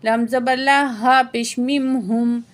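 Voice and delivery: slow, held tajweed recitation; Quran recitation. Shown as a demonstration. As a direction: recite with long vowels and care. Verse recitation to close.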